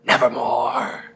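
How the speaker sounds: slow, hoarse raven voice; drawn-out rasping bird utterance; raven's croak. A narrator's reading. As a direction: croak it slowly, low and soft. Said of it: "Then the bird said".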